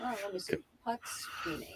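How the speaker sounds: whispered reading to herself; breathy murmured lookup; whispering. Real, not acted. Reading search quietly.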